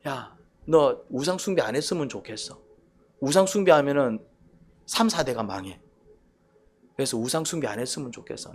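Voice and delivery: soft, gentle, hushed plea; tender pleading. Shown as a demonstration. God pleading with his people, soft and tender. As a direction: coax them lovingly. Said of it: "그런 말투라니까".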